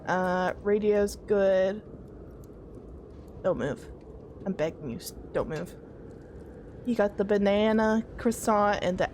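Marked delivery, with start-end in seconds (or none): 3.4-5.7 hushed pleading. Real, not acted. Pleading with enemy not to move.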